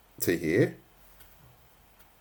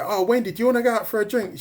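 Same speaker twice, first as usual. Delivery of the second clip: mock polite invite; 10 semitones higher, faster than usual. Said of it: "imagine you say".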